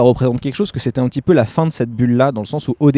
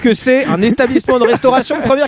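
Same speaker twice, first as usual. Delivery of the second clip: mock restaurant critic; 10 semitones higher than usual. Joking restaurant review voice.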